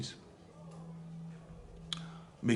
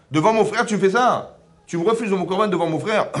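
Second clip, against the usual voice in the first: Cain indignant; 6 semitones higher, 5 dB louder than usual. Acted. Cain complains to Hachem.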